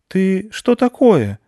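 put-on high, slow, loud childish question; a wary small girl facing a strange creature; drawn-out piping question; little girl asking. Аня asks the creature.

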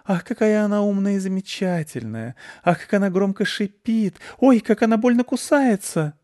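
high, gushing cooing mother; mom cooing over the cat. A narrator's reading. A doting mother coos at the cat from the house.

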